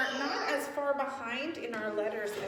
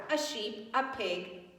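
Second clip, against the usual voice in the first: plain reading voice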